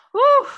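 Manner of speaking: a big, high, loud exhale of relief; emotional whew. Real, not acted. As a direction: blow the feeling out in one loud breath. Emotional exhale after reading letter.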